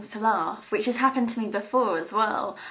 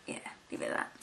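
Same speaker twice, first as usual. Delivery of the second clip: whispered, much softer repeat; 13 dB quieter, much faster than usual. Real, not acted. Signing off the ramble, the closing words whispered.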